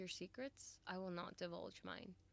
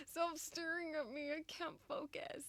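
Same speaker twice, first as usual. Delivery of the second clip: much higher, louder giddy protest; flustered squeal; 10 semitones higher, 4 dB louder than usual. Real, not acted. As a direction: beg him to stop, giddy. Flustered by character's stare.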